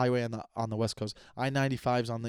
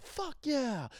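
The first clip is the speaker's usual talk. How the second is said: much higher victory whoop; excited cheer; 13 semitones higher, 4 dB quieter than usual. Retold or imitated. Explaining excitement to listeners, high victory whoop. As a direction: cheer like you won the Super Bowl. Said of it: "like, I won the Super Bowl".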